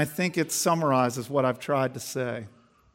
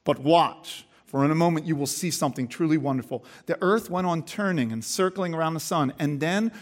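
lively, louder storytelling read; story narration; 4 semitones higher, 9 dB louder than usual. Acted. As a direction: read it like a tale to the congregation. Reads J B Phillips story aloud.

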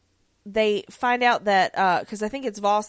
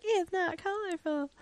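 high, soft little self-quote; small mock complaint; 10 semitones higher, 8 dB quieter than usual. Speaker mocking their own first reaction, small high complaint. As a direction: shrink it into a high, quiet pout.

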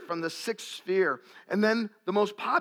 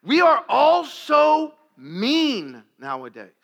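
forceful preaching; 7 semitones higher, 9 dB louder, much slower than usual. Acted. Preaching emphasis after pause.

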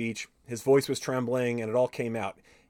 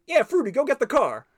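higher, louder put-on star voice, lightly done; Eddie Murphy impression; 12 semitones higher, 5 dB louder than usual. Retelling the Chappelle skit, lightly imitating a star calling to his buddy. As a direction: toss off an order to your buddy, lightly mimicking. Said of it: "doing an impression of Eddie Murphy".

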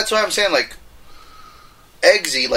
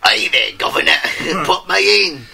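lightly hammed-up English tryout; mock British accent; 5 semitones higher, 3 dB louder, much slower than usual. Tryout for Kingsman: light mock British accent. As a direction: audition like a cheeky Londoner. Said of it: "tryouts for the Kingsman four".

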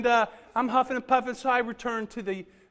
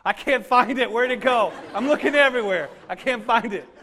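hammed-up panic over a lost breath; winded student joking he can't find his breath; mock panic; 3 semitones higher, 7 dB louder than usual. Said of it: "And I'm like".

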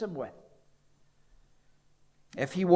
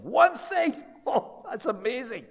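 much higher, mock disbelief; astonished; 8 semitones higher than usual. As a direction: bounce up in sheer surprise. Astonished at only one thing.

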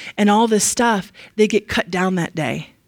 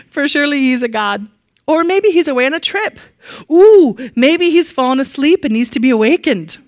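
higher, louder sarcastic taunt; mocking; 5 semitones higher, 4 dB louder than usual. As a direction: tease them with fake concern. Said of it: "Elijah began mocking them".

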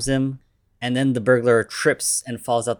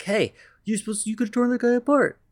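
much higher, fast, put-on voice; indignant promoter; 12 semitones higher, 3 dB louder, faster than usual. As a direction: squawk at the kid in a cartoonish voice. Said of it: "the promoter's like".

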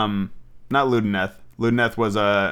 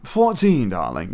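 put-on slight British accent; 5 semitones lower than usual. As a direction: imitate a British lady's "darling" drop. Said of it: "a slight British accent".

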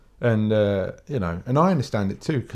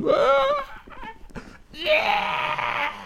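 very high pained cry-shout; distressed yelp. Retold or imitated. Kid crying out at the opticians from an eye drop. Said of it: "Like Rain Man".

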